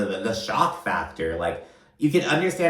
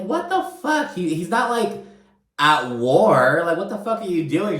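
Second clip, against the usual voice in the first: much higher, stunned disbelief; perplexed outburst; 8 semitones higher, 4 dB louder than usual. Retold or imitated. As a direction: squeak it out in baffled shock. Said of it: "she's, like, so perplexed".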